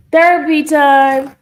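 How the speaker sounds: loud, high, drawn-out call. Real, not acted. Streamer breaking her tearful moment, calling out. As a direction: announce it loudly, stretching the words. Calls out mid-stream after tearing up.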